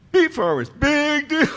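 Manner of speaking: high, sneering heckler voice; mock them from the crowd; sing-song put-down; mocking heckle. Heckler repeating her jeer.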